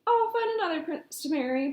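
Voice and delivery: firm, defiant declaration; declaring. A narrator's reading. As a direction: announce it with a pout. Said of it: "Poppy declared".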